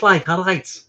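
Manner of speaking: announced Liverpool accent demo. Shown as a demonstration. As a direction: show how Liverpool people say "all right". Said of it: "they talk like this".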